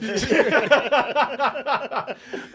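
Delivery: long high cackle; loud laugh. Real, not acted. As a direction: crack up loud. Laughing at the joke.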